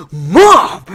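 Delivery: loud, high cry of Mom; shocked kid yelling at his mother; embarrassed yell. Retold or imitated. Kid in the car yelling Mom at his prankster mother. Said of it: "I'm like".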